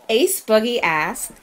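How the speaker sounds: ordinary delivery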